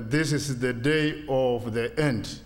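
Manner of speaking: normal talking voice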